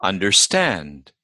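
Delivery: Understand with STAND stressed; Understand, weight on the final syllable; stress on second part. Shown as a demonstration. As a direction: slowly, rise onto STAND in Understand, then fall. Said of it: "always the second word".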